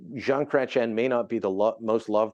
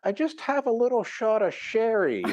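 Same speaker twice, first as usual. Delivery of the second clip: put-on much higher, sweet little old lady; 10 semitones higher than usual. Commentator imitating a hundred-year-old lady: high and sweet. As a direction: play a centenarian sharing her secret. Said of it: "some old lady that turns a hundred".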